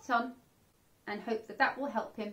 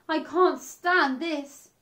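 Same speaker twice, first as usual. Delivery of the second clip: slow, louder fed-up groan; fed-up bear; 5 semitones higher, 7 dB louder, much slower than usual. Acted. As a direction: drag it out, at the end of his rope. Exhausted Bear's last complaint.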